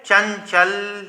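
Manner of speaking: चंचल very slowly, each syllable held. Shown as a demonstration. Teacher giving another example word, syllable-by-syllable. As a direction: draw out चंचल to expose the nasal sound. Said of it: "एक और शब्द देख लेते हैं".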